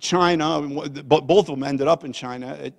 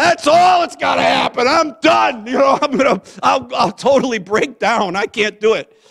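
loud, high, overwrought outburst; retold panic; 10 semitones higher, 10 dB louder than usual. Grandpa imagining the airport goodbye, panicked outburst. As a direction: cry out as if about to fall apart.

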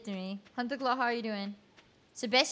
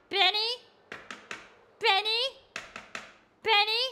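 calling out; 10 semitones higher, 3 dB louder than usual. Real, not acted. Calling for Penny in game.

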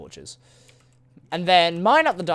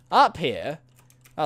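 ordinary talk

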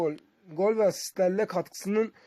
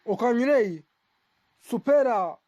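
ordinary talk

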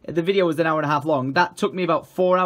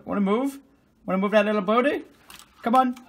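higher, sing-song coaxing; coaxing pet voice; 5 semitones higher than usual. Real, not acted. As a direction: coax the cat off the keyboard.

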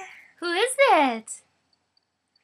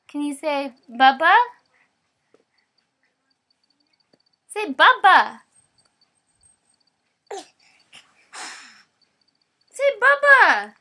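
louder, insistent prompting; loud coaxing; 6 dB louder than usual. Real, not acted. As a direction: keep pushing her, louder each time. Repeatedly coaxing baby.

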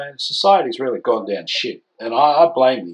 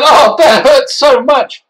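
sarcastic mock hurt; 12 semitones higher, 8 dB louder, slower than usual. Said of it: "I just said".

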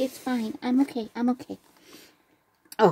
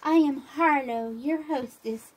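normal talking voice

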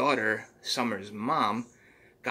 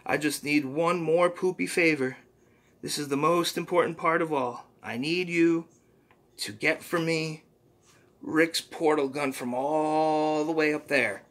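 a hint of the squeaky sidekick, earnest; understated cartoon helper voice; lightly voiced Poopy Butthole; 6 semitones higher, 4 dB louder than usual. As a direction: plead for help gently. Mister Poopy Butthole asks.

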